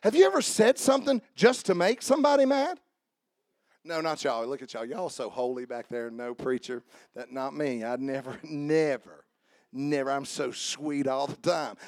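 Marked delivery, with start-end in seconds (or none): 3.8-11.8 mock innocence. Teasing the congregation about holiness.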